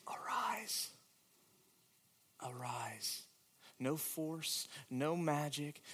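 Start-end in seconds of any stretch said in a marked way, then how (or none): 0.1-0.9 high, breathless whispered command; Jesus whispering to the dead girl. Acted. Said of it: "and whisper". 2.4-3.2 soft, falling repeat; Jesus gently repeating the command; hushed command. Acted.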